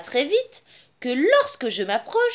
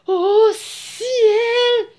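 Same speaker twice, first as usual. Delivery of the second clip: higher, drawn-out cry of dismay; alarmed exclamation; 6 semitones higher, 4 dB louder than usual. A narrator's reading. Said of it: "S'exclama-t-il". (exclamation effrayée)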